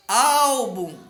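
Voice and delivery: ÁÁÁL-bum, bem alto; penúltima sílaba forte. Shown as a demonstration. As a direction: chame Álbum esticando o ÁL. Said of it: "a penúltima sílaba é a mais forte". (Álbum com ÁL forte e demorado)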